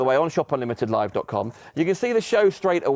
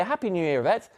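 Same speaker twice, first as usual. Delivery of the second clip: warm greeting; 3 semitones higher, faster than usual. Real, not acted. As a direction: say it gently to her alone. Greeting a viewer by name.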